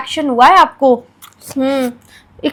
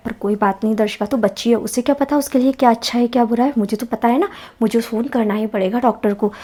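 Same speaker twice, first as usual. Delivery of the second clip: hushed, lower secret plotting; quietly to herself; 7 semitones lower, 9 dB quieter than usual. Acted. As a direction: keep it low so the child won't hear. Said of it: "चुपचाप से doctor को phone लगा देती हूँ".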